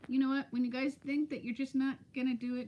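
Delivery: ordinary talk, nothing marked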